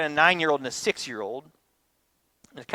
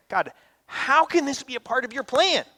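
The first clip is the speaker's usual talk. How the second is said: pleading question; 9 semitones higher than usual. Retold or imitated.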